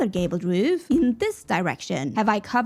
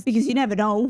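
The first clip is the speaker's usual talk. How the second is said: normal talking voice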